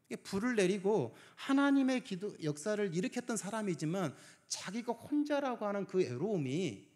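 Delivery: impassioned pulpit delivery; full-force preaching. Acted. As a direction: drive the message home to the congregation. Preaching to congregation.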